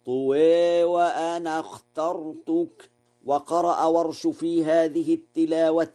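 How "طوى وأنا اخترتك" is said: recited "طوى" ending in a held e, no nunation; Warsh recitation. Shown as a demonstration. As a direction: chant "طوى" as a drawn "tuwee", straight into "وأنا". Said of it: "فيقرأ هكذا". (تلاوة ورش: "طوى" بالتقليل دون تنوين)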